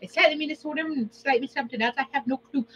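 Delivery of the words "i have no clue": usual speaking voice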